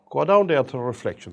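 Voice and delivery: ordinary delivery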